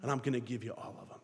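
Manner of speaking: low, soft, steady confidence; a hint of calm defiance; quiet resolve. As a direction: say it quietly, sure of God. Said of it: "you have to say".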